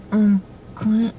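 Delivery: slow, whispery timid imitation; quietest voice, timid. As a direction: mimic a scared kid barely daring to speak. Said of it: "talks in the quietest voice".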